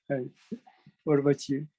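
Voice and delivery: ordinary talk, nothing marked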